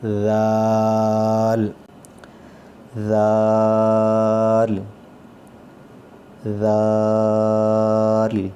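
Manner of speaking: زال with the tongue tip at the teeth, soft, vowel held very long; soft, long dhal. Shown as a demonstration. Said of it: "آواز اس کی نرم آئے گی". (زال نرم اور لمبا)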